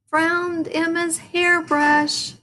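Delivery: high slow called-out find; yell. A narrator's reading. Froggy yelling his find to everyone. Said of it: "yelled Hannah".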